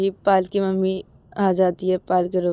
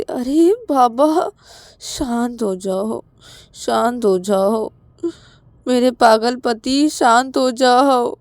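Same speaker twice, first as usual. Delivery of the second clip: slow, stretched-out plea to calm down; drawn-out, coaxing exasperation; exasperated soothing; 3 semitones higher, much slower than usual. Acted. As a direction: draw every word out long and patiently. Acted slow plea to husband to calm.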